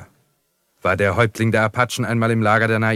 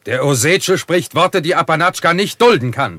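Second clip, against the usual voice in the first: raised, higher indignant outburst; angry protest; 8 semitones higher, 6 dB louder than usual. Acted. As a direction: bristle and push back hard at the insult. Chief offended by another's words.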